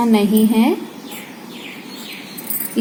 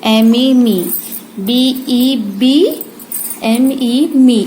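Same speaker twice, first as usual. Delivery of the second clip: slow spelled-out drill; much slower than usual. Shown as a demonstration. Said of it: "इसे हम मी pronounce करते हैं".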